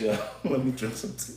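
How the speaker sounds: ordinary talk, nothing marked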